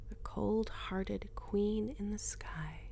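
slow mocking whisper. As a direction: whisper it slowly, mocking herself. Inner voice mocking.

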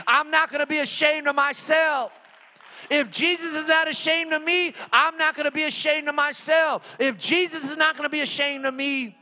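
high, impassioned pulpit testimony; full-force preaching. Real, not acted. Preacher tells how he answered the devil, full-force preaching. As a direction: declare it with mounting conviction.

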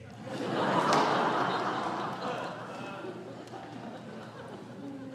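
real amused laugh, breathy and high. Real, not acted. Preacher laughing at his grandson's wise remark, real laugh. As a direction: let a fond laugh out.

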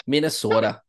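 sing-song Upper Midwest lake accent on "Minnesota". Shown as a demonstration. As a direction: stretch the vowels in "Minnesota" like a Minnesotan, a long round "sooo". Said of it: "lake accents you can do".

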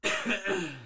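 hard cough off the mic; coughing fit. Real, not acted. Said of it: "let me cough again".

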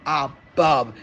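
ordinary delivery

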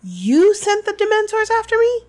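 high, loud incredulous question; astonished accusation, held high; shocked gasp. A narrator's reading. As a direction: pitch jumps up in outraged disbelief. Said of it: "gasped Harry".

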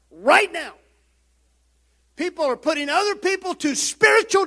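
loud, high fervent preaching; rising pulpit fire; preaching at full force. Acted. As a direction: drive it home from the pulpit, building. Preaching climax on baptism.

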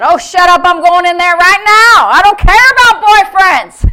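high, full-volume angry retort; defiant shout. Acted. Norval shouts down the devil's voice, furiously defiant. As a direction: yell it down and refuse to be stopped.

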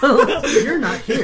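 slow high laughing. Real, not acted. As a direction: laugh through it. Laughing at joke.

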